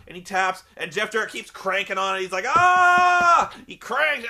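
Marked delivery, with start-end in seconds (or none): 2.5-3.5 loud high held "aah" of agony; long hammed-up yell of pain; mock pain scream. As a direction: imitate a wrestler howling in an armbar. Imitating man still being cranked.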